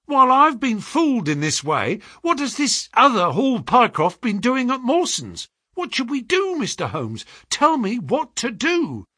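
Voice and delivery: high-pitched, agitated pleading; distressed cry. A narrator's reading. Clerk begs the detective for help, crying out. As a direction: cry out high, begging to be told. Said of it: "he cried".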